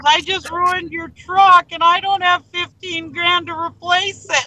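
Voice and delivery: high, drawn-out mock wail; panicked confession. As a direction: imitate a distraught friend fretting aloud. Retells friend panicking over truck.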